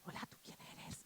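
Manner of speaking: put-on whispered child's question; little girl whispering. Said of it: "una niña me dice".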